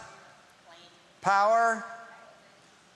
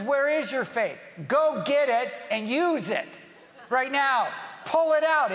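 raised, emphatic voice of command; forceful urging; 6 semitones higher, 4 dB louder than usual. Acted. Jesus challenging his disciples about their faith, forceful. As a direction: press each order home, higher and louder.

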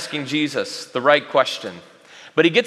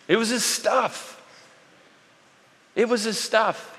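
ordinary delivery

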